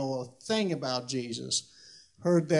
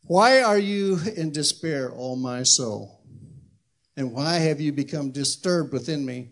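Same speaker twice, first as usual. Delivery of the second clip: scripture reading; 5 dB louder than usual. Acted. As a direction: declaim the psalm, letting phrase ends settle. Reads scripture aloud.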